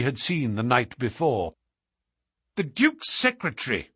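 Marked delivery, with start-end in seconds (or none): 2.6-3.9 sudden startled exclamation, higher; exclaiming. Acted. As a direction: recognize him in surprise. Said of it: "cried Holmes".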